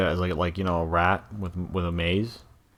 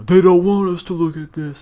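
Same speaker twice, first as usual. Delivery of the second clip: high, loud whiny parody; mock the paranoid crowd; mocking conspiracy voice; 12 semitones higher, 10 dB louder than usual.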